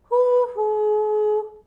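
high, loud anxious hooting; a mother owl calls out in alarm; worried owl hoot. A narrator's reading. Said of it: "Tuti'nin endişeli sesiyle".

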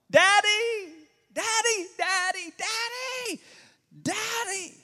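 put-on high, loud child's scream for daddy. Little boy screaming for his dad at a snake. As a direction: cry out like a frightened five-year-old. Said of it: "start screaming out".

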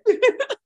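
high burst of laughter; laughing. Real, not acted. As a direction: crack up at the story. Host laughing at the story.

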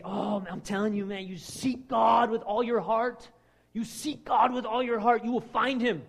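slow, loud, high hammed-up preacher voice; mocking preachy believer. Preacher mocking people who quote scripture glibly, hammed-up. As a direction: overdo the churchy platitude, drawn out. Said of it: "how many people you say".

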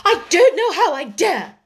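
high, loud, reckless defiance; worked-up and bold; reckless courage. A narrator's reading. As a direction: answer back with sudden daring. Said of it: "with a kind of reckless courage".

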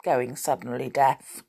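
plain reading voice

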